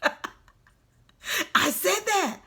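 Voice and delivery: laugh, then get it out in a high voice. Retold or imitated. Laughing at how ridiculous their own words sounded, laughing.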